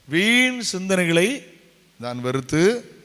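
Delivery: very slow, drawn-out proclamation; ringing, measured scripture reading; solemn verse reading. Real, not acted. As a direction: stretch the opening words out loud and long. Reads the verse out to congregation.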